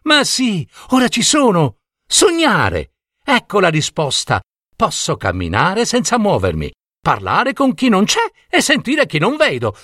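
high, eager discovery; light up as you solve it; excited realisation. A narrator's reading. Jelina solving the fox's riddle, excited.

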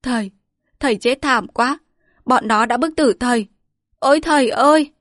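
rising into a high, loud wail; keen over the coffin; wailing grief. Acted. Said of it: "khóc lóc".

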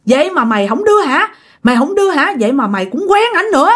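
high-pitched, wailing demands to know why; acted jilted spirit's tirade; scolding accusation. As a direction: berate a friend who betrayed you, pitch climbing sharply. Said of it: "Vừa liếc vừa chửi vừa chỉ nha".

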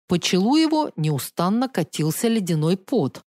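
plain reading voice

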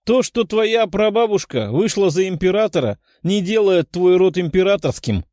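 high-pitched, derisive outburst; incredulous, mocking exclamation; scornful exclamation. A narrator's reading. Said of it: "воскликнула Даша".

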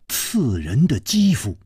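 usual speaking voice

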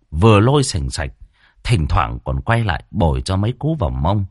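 plain reading voice

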